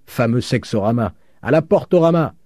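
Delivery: high, loud mocking cry; gleeful shouted taunt; jeering shout. A narrator's reading. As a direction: heckle with a silly flourish.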